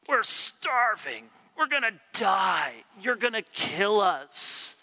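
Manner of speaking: a hammed-up complaining crowd, drawn out; mock whining Israelites. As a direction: ham up the crowd's self-pitying moan.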